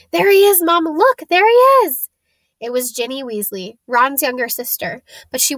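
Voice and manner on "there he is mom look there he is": high, loud excited squeal; excited little girl. A narrator's reading. Young girl to her mom spotting Harry, squealing excitedly. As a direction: cry out in childlike excitement.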